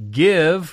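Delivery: preacher landing hard on "Give"; stressed give. Acted. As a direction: punch "Give" as the point of the sermon.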